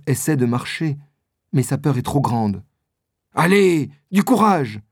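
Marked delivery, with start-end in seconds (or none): 3.3-4.8 raised, rallying voice; higher-pitched, pressing encouragement; urgent encouragement. A narrator's reading. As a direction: urge a frightened companion on. Milady urges frightened Constance on.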